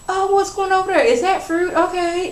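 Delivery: a sing-song excuse to walk away; fake innocent curiosity. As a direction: pretend to notice the fruit so you can leave. Candy fakes interest to walk off.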